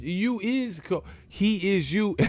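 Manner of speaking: high, drawn-out protest; mock incredulity. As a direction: stretch it out in comic disbelief. Mock disbelief at third-person talk.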